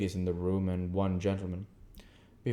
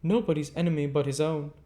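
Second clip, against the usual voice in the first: prim lady; 8 semitones higher, 5 dB louder than usual. Acted. Camilla voiced in the story.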